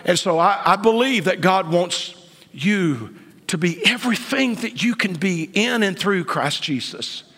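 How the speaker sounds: ordinary talk, nothing marked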